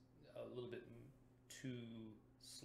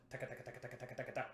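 machine gun tonguing; much faster than usual. Shown as a demonstration. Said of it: "machine gun kind of sound".

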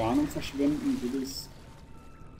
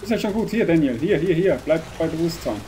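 loud, higher frantic calls; frantic urging; 4 semitones higher, 6 dB louder than usual. Real, not acted. Player in panic calls his character to stay conscious. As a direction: keep him going, frantic.

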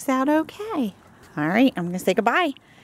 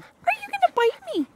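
very high, fast playful squeal; squeaky mock alarm; faster than usual. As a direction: tease the nibbling puppy with a squeak. Mock alarm at puppy nibbling.

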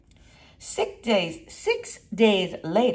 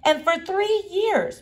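ordinary delivery, nothing marked

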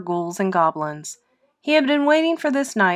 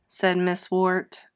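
plain reading voice

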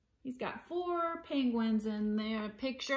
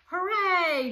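high, loud hooray; cheering; 8 semitones higher, 10 dB louder than usual. A narrator's reading. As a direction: cheer it out jubilantly. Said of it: "cheered Splat".

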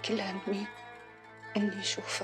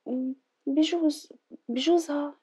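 hesitant drawl; 6 semitones higher, 5 dB louder, much slower than usual. Acted. Hesitant drawn-out doubt.

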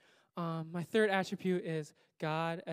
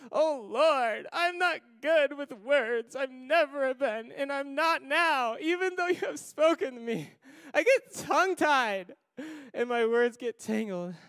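pleading Moses; 5 semitones higher, 5 dB louder than usual. Acted. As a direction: beg the Lord as a nervous stammerer. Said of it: "Moses still pleaded".

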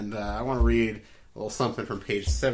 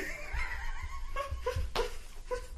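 silent wheeze laugh; 14 dB quieter than usual. Real, not acted. Laughs at own joke.

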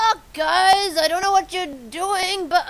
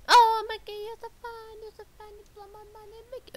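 mock sweet voice; 3 semitones higher, 9 dB quieter, much faster than usual. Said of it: "Okay, here's the real one".

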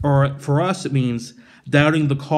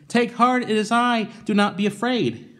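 higher, lifted voice for Jesus; Jesus calling out; 8 semitones higher than usual. Acted. Jesus calling to his frightened disciples, lifted and reassuring. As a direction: call across the water to reassure them.

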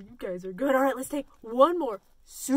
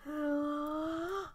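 big breath in; 9 dB quieter than usual. Shown as a demonstration. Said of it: "Let's take a big breath in".

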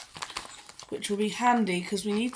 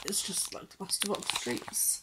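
whispered aside; 10 dB quieter than usual. Real, not acted.

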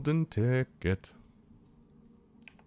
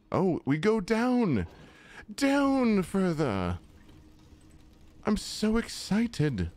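drawn-out excitement; 9 semitones higher, slower than usual. Real, not acted. Descending lift, drawn out.

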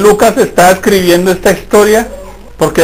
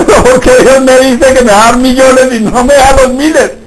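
higher and louder, mock indignant question to God; own puzzled complaint; 8 semitones higher, 5 dB louder than usual. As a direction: act out your past self protesting. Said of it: "Yo decía".

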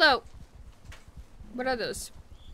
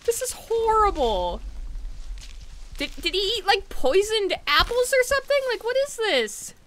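higher pitched baffled dismay; dismayed disbelief; 9 semitones higher than usual. Real, not acted. Player baffled as her animals keep dying. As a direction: ask in disbelief.

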